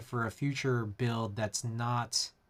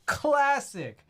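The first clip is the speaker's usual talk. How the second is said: Classic jumped way up in pitch; squeaky high Classic; 10 semitones higher, 4 dB louder than usual. Acted. Streamer echoing a chat catchphrase, much higher. Repeated, second take pitched up.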